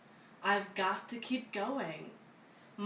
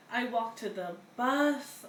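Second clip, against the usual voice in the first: usual speaking voice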